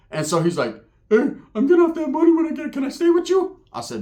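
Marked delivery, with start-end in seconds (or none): 1.1-3.6 squeaky high cartoon-mouse cousin; Mickey Mouse voice. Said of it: "put the Mickey Mouse to it".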